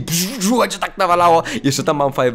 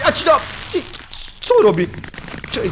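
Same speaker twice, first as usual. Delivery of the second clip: usual speaking voice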